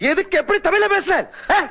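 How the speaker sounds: high-pitched imitation of a woman speaking Tamil; mock woman's voice. Interrogator mimicking last night's Tamil-speaking woman's voice, mocking falsetto. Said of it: "ഒരു സ്ത്രീയുടെ ശബ്ദത്തിൽ".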